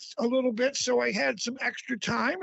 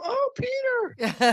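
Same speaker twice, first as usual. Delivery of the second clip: high, slow call across the aisle; imitating an acquaintance; 12 semitones higher, 3 dB louder, much slower than usual. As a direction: sing out a friendly greeting. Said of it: "someone ... will go".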